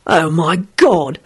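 muttered disgust. A narrator's reading. William to Rosie about the couple, muttering. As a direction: drag out the opening in disgust. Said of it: "William muttered to Rosie".

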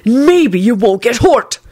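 slow then high shouted threat. A narrator's reading. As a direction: make them take him seriously. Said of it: "he shouted".

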